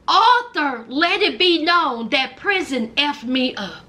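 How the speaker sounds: usual speaking voice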